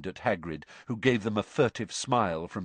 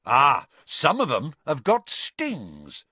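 enthusiastic; 5 semitones higher, 6 dB louder, slower than usual. Acted. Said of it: "said Hagrid enthusiastically".

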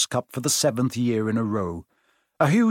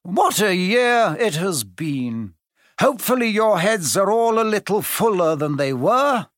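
higher-pitched, jovial speechmaking; cheerful; 7 semitones higher, 3 dB louder than usual. A narrator's reading. Headmaster giving his end-of-year speech, cheerfully. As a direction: address the whole hall with merry flourish. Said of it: "Dumbledore said cheerfully".

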